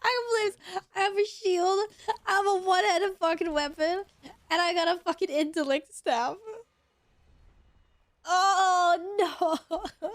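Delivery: high, wailing complaint; whiny lament. Real, not acted. Gamer wailing to viewers about gear, whiny lament. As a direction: whine as if near tears.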